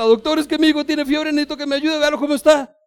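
put-on high, loud frantic plea for a sick child; desperate parent. Worried father begging the doctor to see his son, frantic.

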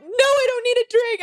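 very high wailing protest; mock distressed refusal. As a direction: whine it way up high, hammed-up. Upset, refusing a drink offer.

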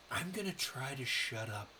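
mildly sheepish, small voice; retold embarrassment. Tourist overheard by the guide, retold with mild embarrassment. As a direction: play a touch embarrassed, pulling back. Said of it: "I was very embarrassed and go".

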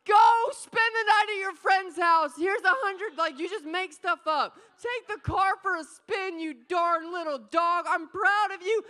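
lavish the dog with wild cheering; hammed-up ecstatic praise of the dog; overjoyed praise. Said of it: "literally be like".

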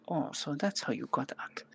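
hushed muttered realization; cat whispers at the window. Acted. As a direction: whisper it to herself. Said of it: "She looks at the window, like".

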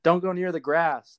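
calling out a warning; yelled warning. Retold or imitated. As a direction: yell it out the window. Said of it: "And he's like".

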